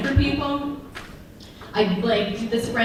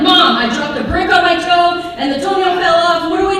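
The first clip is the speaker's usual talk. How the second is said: put-on panicked kid; 6 semitones higher, 5 dB louder than usual. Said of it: "Come running in like".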